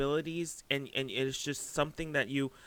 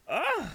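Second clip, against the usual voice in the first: high excited groan; 4 dB louder than usual. Real, not acted. Overwhelmed by excitement.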